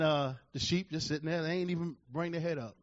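normal talking voice

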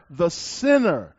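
"the sinner" slow and weighted; emphasis on sinner. Shown as a demonstration. Preacher reading the verse, drawing out "the sinner". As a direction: drag out "the sinner" deliberately. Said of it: "Emphasis added".

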